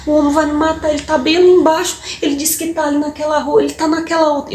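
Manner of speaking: high, desperate begging through tears; scared girl begging a passing couple for help; terrified pleading child. Retold or imitated. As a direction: beg a stranger to save you, panicked. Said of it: "e chorando".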